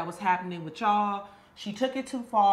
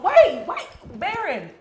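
calling out; 8 semitones higher, 8 dB louder, slower than usual. Acted. Calling friend back as he leaves.

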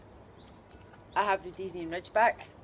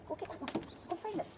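high, soft urging to a dog; high-pitched, quiet encouragement; coaxing dog talk; 10 semitones higher, 6 dB quieter, faster than usual. Real, not acted. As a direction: send the dog off searching in a high voice. Sending the dog to find food.

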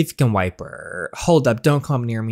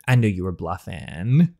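plain reading voice

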